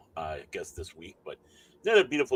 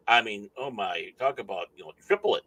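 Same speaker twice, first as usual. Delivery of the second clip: ordinary talk, nothing marked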